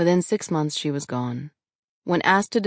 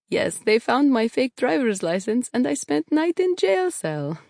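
put-on higher, cheerful voice in a heavy Russian accent; 9 semitones higher, 3 dB louder than usual. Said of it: "said in a heavy Russian accent".

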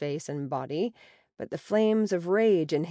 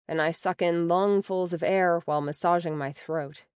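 ordinary delivery, nothing marked

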